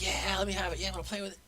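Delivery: put-on high, excited little kid voice; grabby child begging for the toy; eager kid. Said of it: "Most kids would be like".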